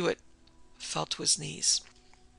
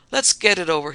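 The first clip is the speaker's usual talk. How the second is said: much louder, curt; brusque opening; 10 dB louder than usual. Acted. Weary lieutenant curtly addressing the assembled suspects. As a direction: get straight to business.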